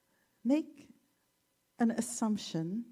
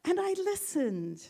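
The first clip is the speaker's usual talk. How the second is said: higher, brighter telling; cheerful frog; 7 semitones higher than usual. Retold or imitated. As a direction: explain it happily, a bit higher. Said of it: "And he said".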